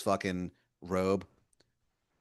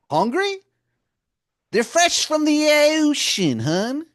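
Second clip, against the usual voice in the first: much higher and louder, a sweet-talking wizard calling you hun; Baltimore wizard voice; 9 dB louder, slower than usual. Acted. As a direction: wheedle like a pushy old wizard begging a favor. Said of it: "And he goes".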